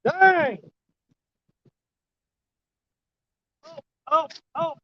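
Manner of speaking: straining, breathless, high and excited; struggling to reel in a heavy fish; fighting a fish. Real, not acted. Struggling with fish near bank.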